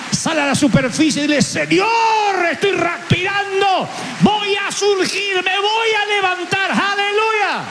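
a slow, soaring, shouted altar call; preaching shout. Acted. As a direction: lift the crowd with every word. Preacher rouses congregation.

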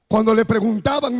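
ordinary delivery

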